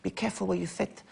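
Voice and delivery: soft, high lisping impression; Chris Eubank. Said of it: "I can do a very good Chris".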